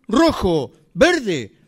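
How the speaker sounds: normal talking voice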